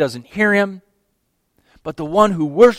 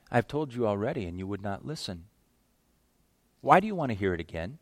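much softer, tired repeat of the line; weary muttered retort; 8 semitones lower, 16 dB quieter, faster than usual. Acted. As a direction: sigh it out quietly, worn down. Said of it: "He rolls his eyes".

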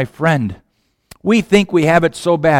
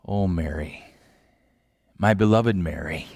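low, much softer, loving; tender and soft; 10 semitones lower, 9 dB quieter than usual. Acted. Jesus tenderly greeting beloved Mary, low and soft. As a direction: say it like a hushed caress.

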